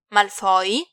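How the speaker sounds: stunned question; bewildered. A narrator's reading. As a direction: ask it as if you can't believe who it is. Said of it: "sussurrò stralunato".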